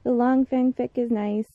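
usual speaking voice

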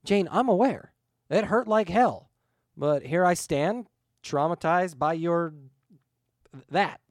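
loud, high, exasperated comeback; indignant retort. A narrator's reading. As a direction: protest loudly, groping for the last word. Indignant reply about his death.

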